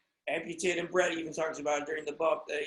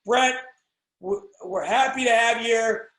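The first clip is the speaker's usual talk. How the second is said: loud, higher gushing super fan host; mock interviewer gushing; 4 semitones higher, 9 dB louder than usual. Host greeting his wrestling hero, a touch gushing. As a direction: greet him like a starstruck host. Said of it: "I would have said".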